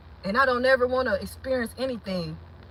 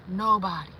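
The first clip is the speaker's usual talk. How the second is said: ordinary delivery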